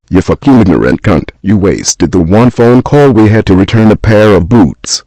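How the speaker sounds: loud, raging insult; furious shout. Acted. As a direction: yell it at her in disbelief. Phone died, he is furious.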